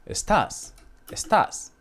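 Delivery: estás with stress on the tás; stress on last syllable. Shown as a demonstration. Teacher repeating the word for you are, estás stressed on TÁS. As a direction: hit the second syllable of estás. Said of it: "we have to pronunciate differently".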